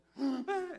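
high, loud mock sob-gasp; imitated crying gasp. Preacher imitating a woman breaking down, loud crying gasp. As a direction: burst out in a pretend crying gasp. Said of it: "she just started crying".

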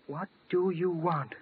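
ordinary talk

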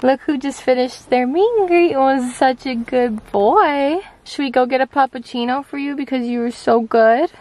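high, loud sing-song pet talk; praising the puppy. Real, not acted. Owner praising her dog after his meet and greet in a high, loud pet voice.

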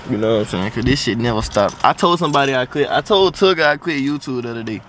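talking around new braces; speaking with braces on. Real, not acted. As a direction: braces on teeth while speaking. Seen 3: metal braces seen on teeth when he smiles and talks.

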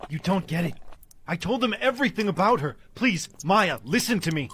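high, loud, frantic appeal; desperate pleading. Acted. John under arrest begging Maya to listen, desperate pleading. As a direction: beg loudly to be heard. Arrested man begs to be heard.

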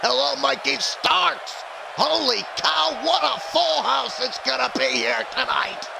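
high-pitched excited announcer impression; Don West. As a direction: holler it like an overexcited ringside commentator. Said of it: "Why did I sound like Don West".

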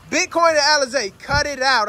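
calling dogs to stop fighting; scolding the dogs. Real, not acted. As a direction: snap them out of it.

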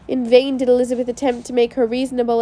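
usual speaking voice

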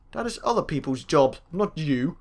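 usual speaking voice

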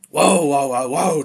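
loud, startled cry to back off; alarmed. Acted. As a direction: blurt it out fast in alarm. Someone pulls a knife on him.

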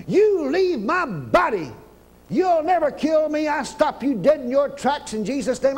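preacher rebukes cancer in a shouted command. Shown as a demonstration. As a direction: command the sickness to leave. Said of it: "you have to talk just like that".

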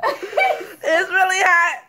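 slow laughing complaint. Real, not acted. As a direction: laugh through the burn. Laughing about the burning noodles.

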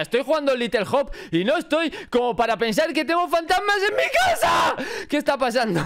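real alarm as the screen goes dark; startled fright. Real, not acted. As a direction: voice jumps up into a real scared shout. Monitor switches off while gaming.

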